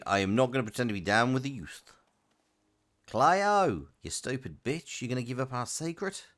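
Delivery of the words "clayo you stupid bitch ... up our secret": put-on terrible Australian accent. Streamer recalling an old meme for chat, terrible Australian accent. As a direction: put on a bad Aussie accent. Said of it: "say Cleo in a terrible Australian accent".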